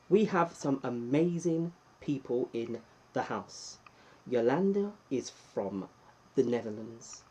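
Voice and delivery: ordinary talk, nothing marked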